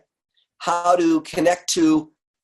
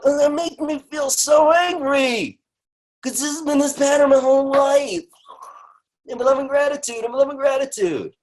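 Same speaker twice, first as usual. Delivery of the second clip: much higher, raised angry venting, slowing down; acted angry answer; 12 semitones higher than usual. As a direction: vent like a frustrated client.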